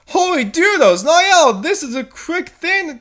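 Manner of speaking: high, squeaky amazement; amazed. Acted. Dee Dee marvelling at Niall's quick lie, high. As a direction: gasp it out high.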